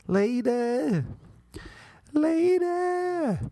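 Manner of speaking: high call of lady. Pretend. Host calling out to an annoying lady. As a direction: call her across the room.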